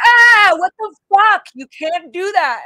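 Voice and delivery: high, loud startled shout at her father, slowly; retold shout. Daughter reliving yelling at her father at the door, loud shout. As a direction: let it burst out, shocked and furious. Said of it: "sound just like".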